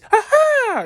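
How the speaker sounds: high, loud squealing "ha ha". Retold or imitated.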